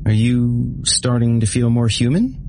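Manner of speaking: put-on high, small kid voice; childish voice. A narrator's reading. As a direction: ask like a naive little girl.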